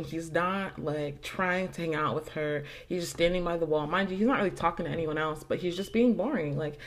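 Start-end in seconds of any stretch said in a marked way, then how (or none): none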